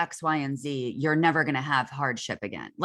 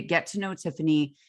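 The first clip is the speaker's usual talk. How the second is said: ordinary talk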